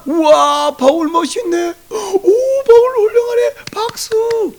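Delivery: high-pitched gushing fan voice; hammed-up high-pitched applause of a fan; mock admiration. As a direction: coo and cheer in exaggerated awe. Mocking imagined crowd admiring Paul.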